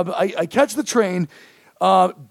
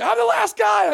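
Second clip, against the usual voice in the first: much higher urgent cry; frantic shout; 11 semitones higher than usual. Retold or imitated. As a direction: yell it while running past the door. Rushing into club, shouting.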